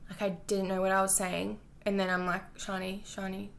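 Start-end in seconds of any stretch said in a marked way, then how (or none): 2.5-3.5 much softer, hushed self-talk; inner voice. Retold or imitated. Urging herself on inside her head, hushed. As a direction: murmur your own name like a thought. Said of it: "like, in my head".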